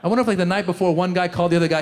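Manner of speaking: ordinary talk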